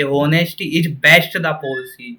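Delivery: "Honesty is best the policy" read very slowly as the wrong version; wrong order, read slowly. Shown as a demonstration. Teacher showing the wrong sentence slowly. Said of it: "कभी भी ये लिखा हुआ नहीं मिलेगा कि".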